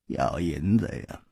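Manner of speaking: sly innkeeper, quietly amused; amused shopkeeper. A narrator's reading. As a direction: answer with sleepy amusement. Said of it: "却笑了".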